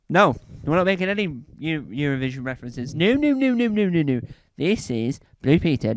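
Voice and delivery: ordinary delivery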